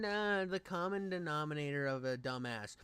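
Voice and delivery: ordinary talk, nothing marked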